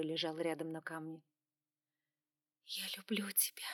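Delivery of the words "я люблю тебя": soft breathless whisper. A narrator's reading. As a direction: whisper it tenderly. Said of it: "прошептала я".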